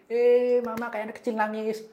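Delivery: put-on child telling mama she is crying, high child voice; child's voice. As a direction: voice a little child looking up. Small child speaks to mother.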